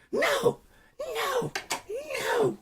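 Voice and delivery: very high squealed refusals; screaming little kid. Acted. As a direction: squeal each one like a tantruming child. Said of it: "he just kept screaming like a little kid".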